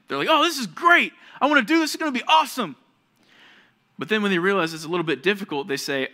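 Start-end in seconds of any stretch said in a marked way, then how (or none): 0.3-2.8 much higher, louder mock enthusiasm; hammed-up rush of eager delight; gushing excitement. Preacher mocking fickle people's new craze, hammed-up excited. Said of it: "They get excited about something".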